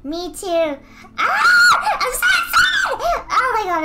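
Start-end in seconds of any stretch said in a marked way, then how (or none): 1.2-3.3 very high, loud squealing shout; excited shriek. Real, not acted. Streamer shrieking with joy about the animation, very high and loud. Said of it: "the most excitement I've heard from you". 3.3-3.8 excited. Real, not acted. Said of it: "the most excitement I've heard from you".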